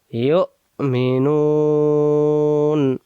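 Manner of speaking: ইউমিনুন stretched very slowly with a falling end; drawn-out recitation. Shown as a demonstration. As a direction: chant ইউমিনুন long and slow. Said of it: "আম লাম". (খুব ধীরে টেনে পড়া)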